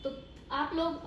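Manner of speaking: ordinary delivery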